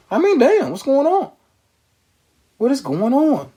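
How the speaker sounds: high, pained "what is going on?"; dismayed disbelief. Real, not acted. Shocked host asking viewers what is going on. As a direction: throw the question up in shock.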